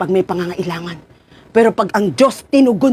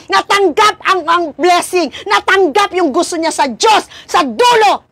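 high, fervent preaching voice; 8 semitones higher than usual. Acted. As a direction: press the point on the congregation. (mataas at masidhing pangangaral)

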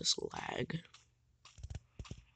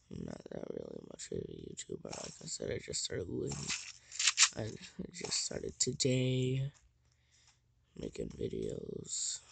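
tired, hoarse and raspy. Real, not acted. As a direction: talk through a scratchy throat. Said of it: "please forgive me for my ultra raspy voice".

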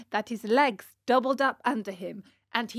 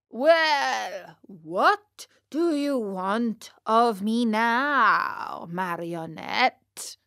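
slow, loud, stretched-out grandee; pompous puppet master softening; proud, flattered indulgence; 5 dB louder, much slower than usual. Acted. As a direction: draw it out like a pleased excellency. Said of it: "smiled proudly, as he said to Pinocchio".

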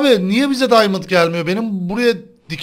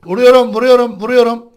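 excited fight call; 4 semitones higher, 7 dB louder than usual. Real, not acted. Mid-fight calling out.

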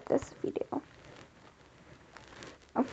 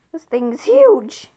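loud, slow amazed exclaim; slow loud wow at a huge thing; 12 dB louder, slower than usual. Real, not acted. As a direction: marvel at it out loud, slowly. Amazed at big item.

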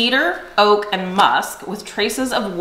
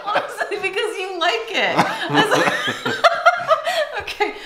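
high-pitched, helpless giggling through words; cracking up, voice pitched way up; real laughter; 13 semitones higher than usual. Real, not acted. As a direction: talk through a fit of laughter. Cracking up over a surprise.

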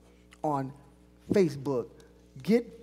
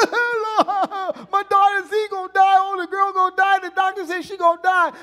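mock wailing plea; 9 semitones higher, 4 dB louder than usual. Pastor mocks a panicking believer in prayer, high mock wail. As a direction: play the panicked crier, over the top. Mocking a crying courtroom plea.

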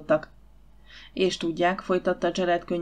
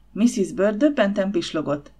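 plain reading voice

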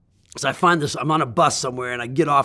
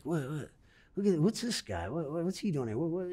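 soft, quiet puzzled murmur; murmured curious crowd; 12 dB quieter than usual. Retold or imitated. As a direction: mutter like a curious onlooker. Imitating curious villagers' murmurs.

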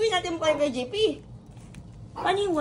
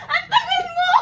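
gasping and laughing under the film; frantic, high and loud, short of air; breathless panic; 13 semitones higher, 5 dB louder than usual. Real, not acted. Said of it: "Hindi ako makahinga!".

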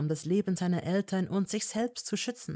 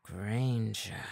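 low warning name; growled; 7 semitones lower than usual. A narrator's reading. Malfoy warning Hermine, low growl. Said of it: "knurrte er".

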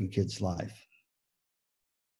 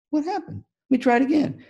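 puzzled Shamu; 13 semitones higher, faster than usual. Acted. Speaker voices Shamu for teachers, high and baffled. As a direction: wonder aloud where the fish went.

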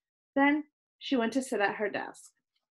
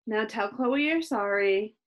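usual speaking voice

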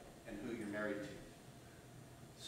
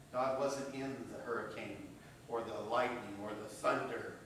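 ordinary talk, nothing marked

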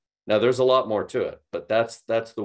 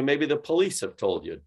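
usual speaking voice

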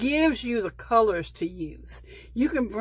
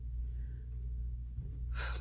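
long, airy exhale; sigh; 12 dB quieter than usual. Real, not acted. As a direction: let out a long sigh to calm down. Overwhelmed, tells herself to calm.

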